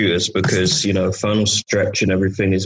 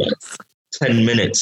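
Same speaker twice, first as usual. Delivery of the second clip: usual speaking voice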